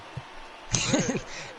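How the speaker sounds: breathy, high giggle; amused burst of giggles; giggling laugh. Real, not acted. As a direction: crack up at your own story. Cracking up at the scare.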